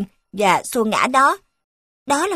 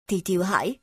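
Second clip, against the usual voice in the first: ordinary delivery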